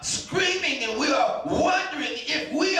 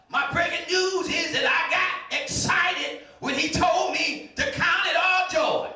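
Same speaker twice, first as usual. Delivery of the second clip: preaching at full force; 5 semitones higher than usual. Acted. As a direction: rouse the congregation with rising fervour.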